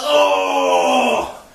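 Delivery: low guttural cry; deep dying groan. Acted. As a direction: groan low as you fall. Death cry.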